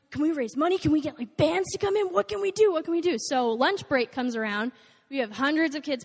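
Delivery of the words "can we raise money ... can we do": higher, eager rush of questions; fired up. Retold or imitated. Eager student begging the roadie how to help, excited. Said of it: "all fired up".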